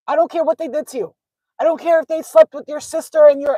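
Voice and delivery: loud, high-pitched tirade; heated scolding. Real, not acted. Tarot reader tells viewers to let go, heated scolding. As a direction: hammer it out, loud and worked up.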